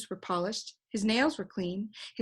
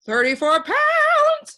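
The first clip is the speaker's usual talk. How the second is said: very high, loud, slow shriek; piercing drawn-out shriek; 12 dB louder, slower than usual. A narrator's reading. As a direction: shriek it as he flies off. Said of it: "shrieked the bird".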